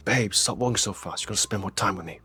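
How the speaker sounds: angry hushed voice, pulled close; whisper yelling. Retold or imitated. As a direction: scold her under your breath. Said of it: "starts doing that angry whisper we all do".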